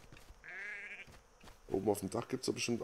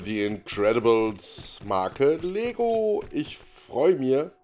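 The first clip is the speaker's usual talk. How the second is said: playful drawl; 4 semitones higher, 6 dB louder, much slower than usual. Acted. Jokey intro of Lego episode.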